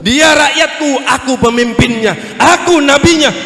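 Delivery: loud, high bursts of conviction; forceful declaration. Acted. Prophet claiming his people before the angel, loud and forceful. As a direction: proclaim each phrase as a claim.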